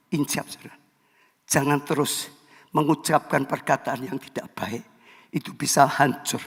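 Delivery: hushed, tender call to repent; soft pastoral appeal. Real, not acted. Pastor calling listeners to repent, soft tender appeal. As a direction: lean in and plead gently.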